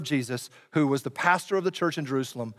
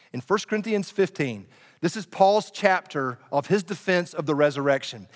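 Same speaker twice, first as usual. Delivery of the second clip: ordinary talk, nothing marked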